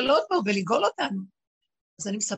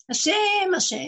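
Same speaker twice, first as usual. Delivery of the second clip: high, loud cry of השם; crying out השם twice, high and loud; fervent call; 10 semitones higher, 6 dB louder, slower than usual. Real, not acted. Calls out God's name fervently.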